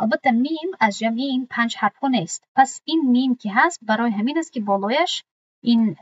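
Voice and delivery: ordinary talk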